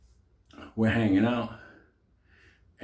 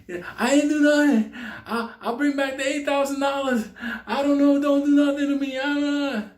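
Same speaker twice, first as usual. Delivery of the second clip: high frantic begging; panicked pleading hostage; 10 semitones higher than usual. Retold or imitated. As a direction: plead for your life in a high voice. Freed hostage begging for mercy.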